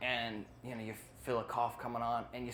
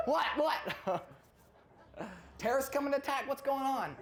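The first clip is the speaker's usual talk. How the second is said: high startled mock panic; 12 semitones higher than usual. Startled during a movie by brother's sneeze, mock panic. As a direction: jump out of your seat, playfully.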